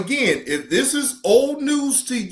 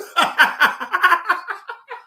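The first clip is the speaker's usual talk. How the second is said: high, helpless cackle; hearty laugh; 10 semitones higher, 4 dB louder than usual. Real, not acted. Streamer tickled at being cursed out, hearty laugh. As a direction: burst out in a long high laugh.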